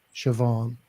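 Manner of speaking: Siobhan said with a V in the middle, "shuh-VAWN"; V sound. Shown as a demonstration. Explaining Irish BH to listeners, Siobhan with a V sound. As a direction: pronounce the BH in Siobhan as a clear V. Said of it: "the V sound".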